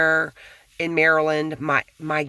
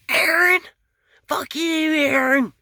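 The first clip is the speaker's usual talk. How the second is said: screeching mock yell, much higher and louder; imitating a neighbor's yell; 13 semitones higher, 6 dB louder than usual. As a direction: ham up a furious neighbor screaming across the yard. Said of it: "I hear 'em yelling at each other".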